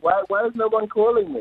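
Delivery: high-pitched mock bewilderment; playfully plaintive, pitched well up; mock dismay. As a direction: wonder aloud in comic, high-voiced dismay. Jokingly quotes own past puzzlement.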